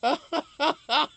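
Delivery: high, loud laugh; loud burst of laughter. Real, not acted. Streamer laughing hard at a chat joke, loud laugh.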